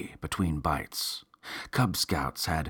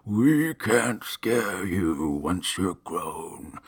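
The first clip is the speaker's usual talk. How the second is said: slow, drawn-out weary monster; much slower than usual. A narrator's reading. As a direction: drag each word like a tired ghoul. Same monster continues.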